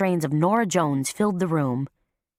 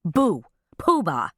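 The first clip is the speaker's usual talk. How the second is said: commanding call; slower than usual. A narrator's reading. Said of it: "commanded in a loud, take-no-prisoners voice".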